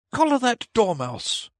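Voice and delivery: high, loud shrieking queen. A narrator's reading. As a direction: shriek the order furiously. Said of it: "the queen shrieked out".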